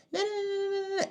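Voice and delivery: very high rapid nah-nah-nah; high-pitched mimicked yammering; mock nagging noise. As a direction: squeal out mocking chatter. Mimics her talking back, cut off.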